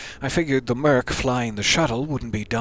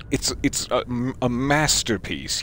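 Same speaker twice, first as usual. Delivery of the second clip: ordinary talk, nothing marked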